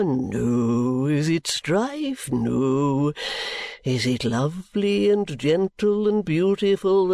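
high, slow, unctuous sermonising; oily preacher voice, drawn out; pompous preacher. Acted. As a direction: play the greasy self-satisfied preacher. Chadband's sermon.

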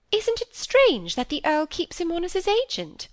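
high-pitched, querying spinster; mildly scandalised query; puzzled wonder. A narrator's reading. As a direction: question it in naive bewilderment.

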